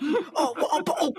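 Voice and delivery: loud, laughing Cockney with dropped t's; laughing mock Cockney; Cockney accent, laughing. Shown as a demonstration. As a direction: shout it in Cockney through laughter. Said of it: "that's Cockney".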